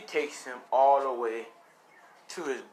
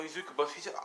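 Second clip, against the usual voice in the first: ordinary delivery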